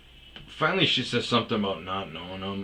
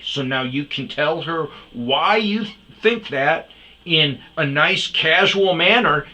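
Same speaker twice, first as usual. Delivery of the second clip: angry telling-off; 7 semitones higher, 10 dB louder than usual. Retold or imitated. Narrator ordering the old guy to talk nicely or leave, angry. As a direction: lay down the ultimatum word by word. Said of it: "It was more yelling".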